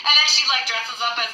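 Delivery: normal talking voice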